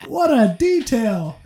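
high, loud stunned disbelief; mock outrage at the detail, drawn out; shocked. Telling friends about a hookup, high shocked disbelief. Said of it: "And I was like".

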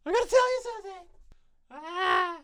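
high, loud urgent cry; Finn impression. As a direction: blurt it out desperately. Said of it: "Finn's entire character arc".

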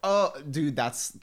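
ordinary talk, nothing marked

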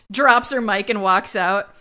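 ordinary delivery

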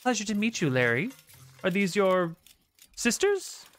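high, sweet, shy little gnome voice; gentle gnome shopkeeper. Acted. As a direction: play a tiny gentle gnome, delighted. GM voices Valence.

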